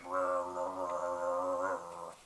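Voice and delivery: low, soft, drawn-out rumble; long low creature growl; dragon growl. Acted. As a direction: grumble wordlessly like a dragon. Dragon answers the knock.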